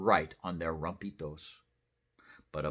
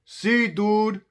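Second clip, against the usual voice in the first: high, loud laid-back dog; cartoon surfer dog; Chihuahua voice; 12 dB louder than usual. Dogs reply.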